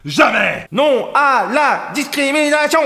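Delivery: high, slow protest shout; hammed-up indignant cry; mock outraged protest. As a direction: proclaim it like a slogan, high and drawn out. Mock protest while reading game.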